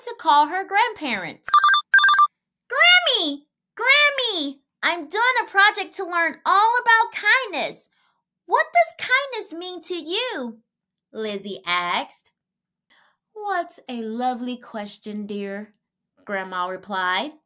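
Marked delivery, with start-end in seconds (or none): 2.7-10.6 put-on child calling out. A narrator's reading. 13.3-15.8 soft grandma voice; gentle grandma. A narrator's reading.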